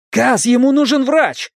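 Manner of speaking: high, urgent, alarmed; desperate plea. Acted. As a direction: beg for help for the wounded. Wounded friend bleeding.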